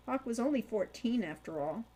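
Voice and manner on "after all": usual speaking voice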